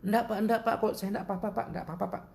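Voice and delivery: high-voiced imitation of a polite youth; reassuring young man. Young man reassures him, acted.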